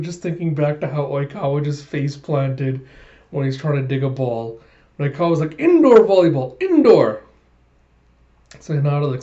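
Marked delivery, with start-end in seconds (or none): 5.6-7.2 loud, higher mock protest; indignant correction. Retelling Oikawa correcting the teasing brothers, mock indignant shout. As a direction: insist on it with exaggerated offence.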